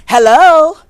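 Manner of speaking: loud hello. Real, not acted. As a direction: call out Hello? like it's obvious. Emphatic rhetorical exclamation.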